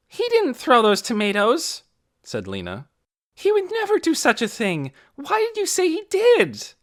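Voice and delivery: loud, high indignant scold; girl scolding a liar; angry scolding. A narrator's reading. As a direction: tear into him for lying. Lena scolding Torrin.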